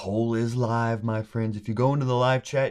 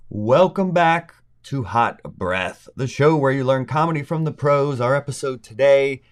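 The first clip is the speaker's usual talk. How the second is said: louder announcer intro voice; show intro; 3 semitones higher, 4 dB louder than usual. Acted. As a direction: open the show like a host.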